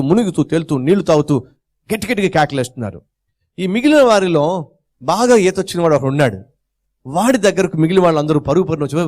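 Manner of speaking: ordinary delivery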